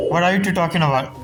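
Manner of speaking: ordinary delivery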